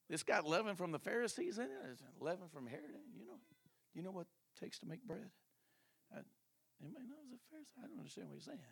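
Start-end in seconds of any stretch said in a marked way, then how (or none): 3.1-8.6 confused whispering. Acted. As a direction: whisper to each other, puzzled.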